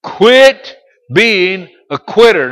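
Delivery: high, loud and slow, quit punched; preaching shout. Acted. Preacher drilling the congregation, loud and slow. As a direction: belt it from the pulpit. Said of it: "Would you say that with me?".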